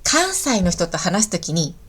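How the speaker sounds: normal talking voice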